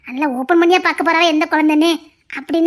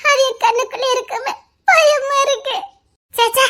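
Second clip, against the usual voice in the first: crying, much higher and strained; tearful fear; 6 semitones higher, slower than usual. Acted. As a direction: break down in fearful tears.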